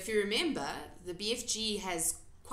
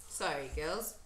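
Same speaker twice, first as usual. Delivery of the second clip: ordinary delivery, nothing marked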